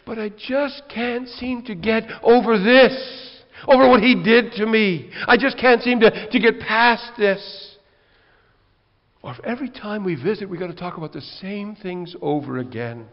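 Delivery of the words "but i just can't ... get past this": louder and higher, voicing a hurt churchgoer's plea; a hint of a struggling believer, loud; pleading congregant. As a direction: beg to be understood, slowing on the last words. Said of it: "Or they say".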